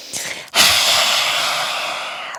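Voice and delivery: long breathy exhale, tongue out; lion's breath exhale. Shown as a demonstration. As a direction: breathe out hard with a roar. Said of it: "tongue out and make some noise".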